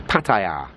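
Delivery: PATTAYA as pat-eye-YAH, long open final syllable; pronunciation one. Shown as a demonstration. Vlogger repeating the preferred way, Pattaya as pat-eye-YAH. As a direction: say Pattaya with TIE in the middle and a drawn-out YAH. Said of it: "this is pronunciation one".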